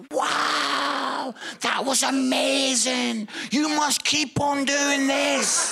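high, slow, drawn-out awe; hammed-up earnest praise; gushing amazement. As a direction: imitate an over-impressed admirer. Minister's gushing praise acted out.

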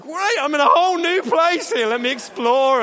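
bright delight of a clueless hamster. Pretend. Hamster arriving back where it started, high eager shout. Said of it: "They must think".

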